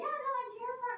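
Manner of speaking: high-pitched, awkward imitation of herself panicking; panicked high voice. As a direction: voice shooting way up, nervous laugh. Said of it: "Your voice goes up nine octaves".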